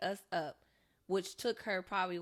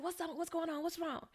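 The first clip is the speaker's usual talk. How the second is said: a startled mother just woken, asking what's wrong; sleepy worried mom; 10 semitones higher than usual. Retold or imitated. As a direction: mom jolted awake, high and hushed with worry.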